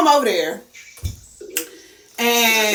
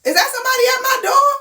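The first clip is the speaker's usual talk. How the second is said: much higher, fast supervisor voice; mock supervisor; 12 semitones higher, faster than usual. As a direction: call through the door, startled. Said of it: "I hear the person say".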